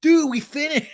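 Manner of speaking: high, loud whiny imitation; Sandoval impression. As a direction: protest it high, cracking up at the end. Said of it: "And Sandoval goes".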